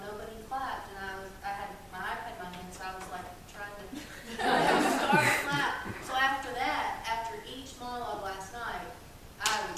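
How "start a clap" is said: laughing. Real, not acted. Laughs telling own attempt.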